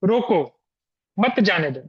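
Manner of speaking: रोको stressed, pause, then मत जाने दो. Shown as a demonstration. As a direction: punch रोको and stop before मत जाने दो. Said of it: "देखो उदाहरण".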